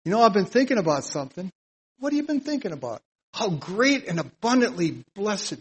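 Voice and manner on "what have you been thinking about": curious friend asking. Pretend. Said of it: "Tell them stuff like that".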